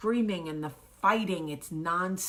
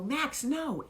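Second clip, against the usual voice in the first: high-pitched retold cry of alarm; alarmed scolding; 9 semitones higher than usual. Mother stopping Max hitting his sister, alarmed scolding.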